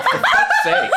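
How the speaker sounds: high laughing complaint; laughing exasperation. Real, not acted.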